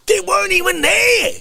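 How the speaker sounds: How dare you, shouted; indignant rant. Real, not acted. Indignant rant defending show.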